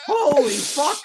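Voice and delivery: high-pitched retold astonishment; amazed reaction. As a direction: say it high, as a stunned onlooker. Retelling own amazed reaction.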